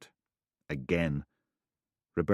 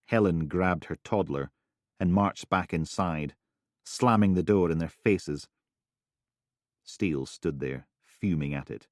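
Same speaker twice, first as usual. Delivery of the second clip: ordinary delivery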